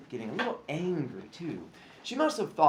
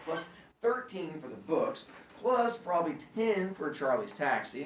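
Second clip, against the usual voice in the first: plain reading voice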